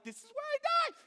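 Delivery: high, strained wail, played up; hammed-up doomed cry; mock panic. Player retelling the Hulk man's charge, high-pitched panic.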